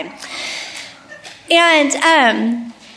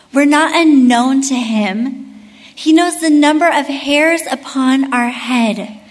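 ordinary delivery

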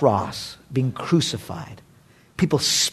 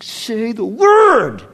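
eager angels; 9 semitones higher, 7 dB louder, much slower than usual. Retold or imitated. Angels voiced urging Jesus.

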